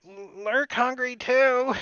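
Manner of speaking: the goblin begging for food too. Acted. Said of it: "you hear the goblin behind you".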